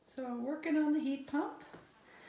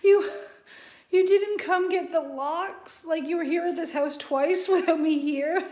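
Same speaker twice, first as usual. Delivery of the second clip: exasperated disbelief; 5 semitones higher, 7 dB louder than usual. Retold or imitated. Retells disbelief at locksmith.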